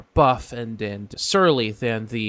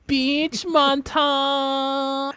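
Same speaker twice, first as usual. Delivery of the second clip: campy sing-song; 14 semitones higher, 3 dB louder, much slower than usual. Acted. GM sings out campy phrase.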